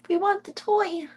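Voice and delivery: squeaky high voice for the kitten; high baby voice. Acted. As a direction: coo it up high like baby talk.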